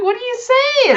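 high-pitched incredulous question; mock bafflement. Viewer replays not understanding the French rants, baffled. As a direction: pitch it way up, acting totally lost.

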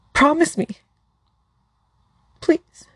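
high, cracking plea; a pleading voice that cracks. Acted. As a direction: beg him as your voice breaks. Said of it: "His voice cracked".

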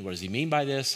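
normal talking voice

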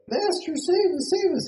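high frantic plea for rescue; pleading disciples. Retold or imitated. Disciples wake Jesus begging for rescue, in a high panicked plea. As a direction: beg him, voice jumped way up in fear. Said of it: "they're like".

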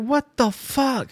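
high, slow, stunned disbelief; retold bafflement. Diner among laughing strangers, slow high bafflement. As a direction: stretch each word out high.

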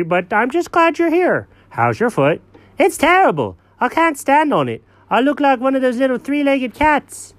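high cartoon cat, sorry for himself; a high cat moaning about his hurt foot; high-pitched cat complaining about his foot; whiny cat voice.

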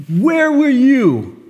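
cross-examine God like a prosecutor; accusing prosecutor. Retold or imitated. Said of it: "we kinda take the tone, put God on a dock".

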